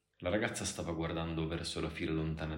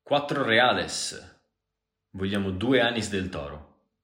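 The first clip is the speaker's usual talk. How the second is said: loud, raised voice with higher pitch; calling out; 4 semitones higher, 12 dB louder, slower than usual. Acted. Price and order called between barwoman and customer, loudly. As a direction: project it across a bar.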